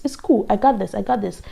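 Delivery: ordinary delivery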